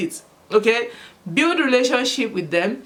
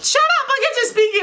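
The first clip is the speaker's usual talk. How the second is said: high-pitched imitation of bickering classmates; high, hammed-up telling-off; mock shushing. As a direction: squeal it like kids hushing each other. Imitating classmates hushing each other.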